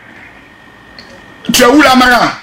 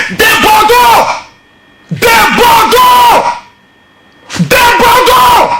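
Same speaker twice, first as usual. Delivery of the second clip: high, drawn-out shout of the name; 12 semitones higher, much slower than usual. Acted. Commentator calls out Des Bordeaux in a high, slow shout. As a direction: call him out across the room, slow and high.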